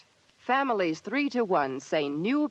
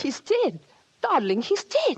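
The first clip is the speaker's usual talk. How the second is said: distraught shock; 8 semitones higher, 4 dB louder than usual. Acted. Shocked at news of death.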